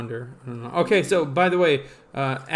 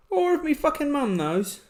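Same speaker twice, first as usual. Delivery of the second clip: much higher, exaggerated British accent; mock British accent; 11 semitones higher than usual. Escalates the mock British version.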